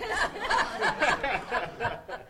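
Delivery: high, airy laugh of delight; long, falling laugh; real laugh. Real, not acted. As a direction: laugh at his own story. Laughs after his own retold line.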